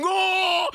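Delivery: very high, loud cartoon "Oh!"; squealed alert cry; mock gif exclamation. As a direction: blast it like a sub alert.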